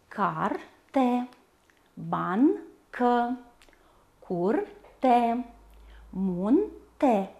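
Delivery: each word split between its two consonants; despărțit în silabe. Shown as a demonstration. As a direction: pause midway through each word. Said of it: "despărțirea în silabe se face". (cuvinte rostite pe silabe, cu pauze)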